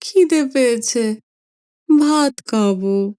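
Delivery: put-on slow childish old man's plea; whining grandfather. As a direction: feeble grandfather asking for food. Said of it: "ঠাকুরদাদা আবার বললেন".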